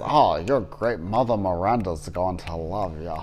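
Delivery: lightly voiced hammy game villain; a hint of a theatrical bad guy; mildly hammed-up villain line; cheesy villain voice. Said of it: "he talks like this".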